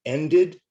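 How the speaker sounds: "Ended" in two clear syllables, high, loud and rising. Shown as a demonstration. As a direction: teach "Ended" with -ed as its own syllable. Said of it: "two syllables".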